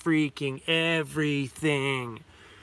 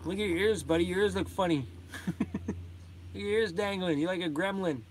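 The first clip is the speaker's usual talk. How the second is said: pet talk; 4 semitones higher, 6 dB quieter than usual. Real, not acted. Cooing at the dog's ears.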